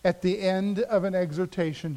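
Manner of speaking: normal talking voice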